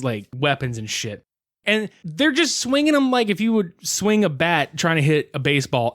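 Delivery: ordinary talk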